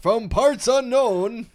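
wrestling announcer. Pretend.

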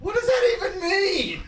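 high, drawn-out laughing squeal. Real, not acted. Co-host cracking up at the Canadian's remark, laughing squeal. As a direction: squeal it out through helpless laughter.

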